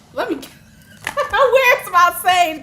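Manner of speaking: high, loud laughing plea; cracking up while reaching for her sage. Real, not acted. Reader laughing, asking for her sage to cleanse.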